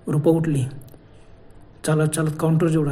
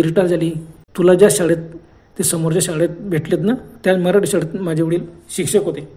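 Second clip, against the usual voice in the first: ordinary delivery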